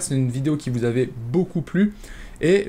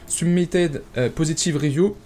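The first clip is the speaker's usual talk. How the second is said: English read with a heavy French accent; real French accent on English words, hesitating midway; broken English accent; 3 semitones higher, slower than usual. Real, not acted. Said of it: "Désolé pour mon accent anglais totalement pété". (anglais avec un gros accent français)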